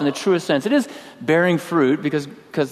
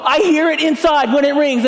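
"hear it inside" yelled much higher and louder; angry yell; 12 semitones higher, 5 dB louder than usual. Retold or imitated. To the little girl at the door, furious yelling. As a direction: shout the rule at the child. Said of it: "and I'm yelling".

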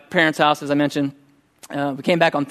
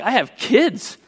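delighted realization; 7 semitones higher, much slower than usual. Retold or imitated. Sudden grateful realization.

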